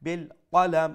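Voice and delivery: "কলম" loud, with a heavy back q; emphatic heavy qaf in "বিল কলম"; full-mouth qaf. Shown as a demonstration. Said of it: "কফ, again full mouth করে উচ্চারণ করবো".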